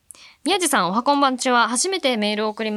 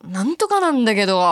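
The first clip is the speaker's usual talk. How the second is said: louder, exaggerated grumbling tail; disgruntled Hakata intonation; 5 dB louder than usual. Shown as a demonstration. Host showing the Hakata accent, hammed-up disgruntled. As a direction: push the ending out as a loud gripe. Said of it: "怒りも不満そうな。".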